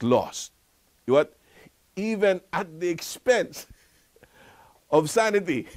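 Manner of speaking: hammed-up teasing rebuke; mocking rebuke. Jesus chiding people obsessed with not losing things, mock scolding. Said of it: "Jesus is basically saying".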